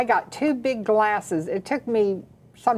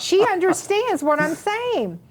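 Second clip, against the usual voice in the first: much higher, astonished inner thought; amazed realization; 9 semitones higher than usual. Retold or imitated. Wife realizing her comatose mother-in-law understands, astonished and high. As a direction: marvel at it, voice jumping up high. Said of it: "'Cause I thought".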